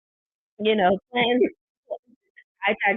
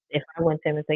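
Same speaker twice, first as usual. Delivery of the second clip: ordinary talk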